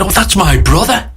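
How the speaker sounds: high-pitched retold exclamation; imitating a friend's surprise; delighted surprise. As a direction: react as if happily taken aback, pitched well up. Retells friend's surprised reaction at barrier.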